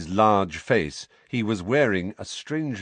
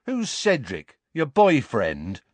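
taunting; 5 semitones higher than usual. Acted. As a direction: jeer at him. Dudley taunting.

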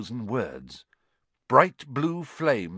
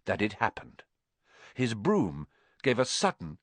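usual speaking voice

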